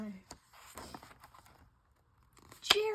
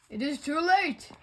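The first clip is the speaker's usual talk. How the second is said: ordinary delivery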